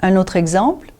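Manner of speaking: ordinary talk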